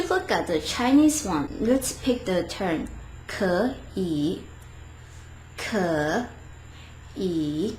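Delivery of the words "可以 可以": 可以 as two separate slow syllables, each with its full dipping tone; 可以 slowly, with a full third tone on each syllable. Shown as a demonstration. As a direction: draw out 可, then 以, each falling and rising. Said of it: "try to say it tone by tone".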